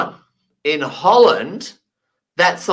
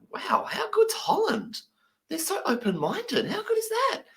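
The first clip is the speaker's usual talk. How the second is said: high, hushed, breathless wonder; amazed whisper; 6 dB quieter than usual. Retold or imitated. English mate marvelling at the old guys, high whisper. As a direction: whisper it in disbelief. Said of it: "He's like".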